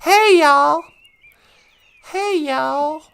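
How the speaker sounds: mock Southern drawl greeting; Southern accent. Asking Gwen if Nashville talks so, mock Southern accent. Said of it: "Do they have a southern accent".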